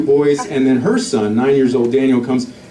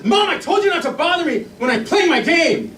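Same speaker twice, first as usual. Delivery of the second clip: high-pitched mock tantrum of a nine-year-old; shrieky impression of an angry son; angry kid; 13 semitones higher than usual. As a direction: imitate a boy losing it at his mom. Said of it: "zero to angry like that".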